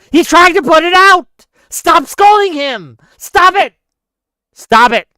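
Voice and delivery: high-pitched, full-volume furious shouting; real enraged shouting, hammering each phrase; angry yelling. Real, not acted. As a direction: yell in outraged exasperation at the scolds. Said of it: "I could hear you yelling".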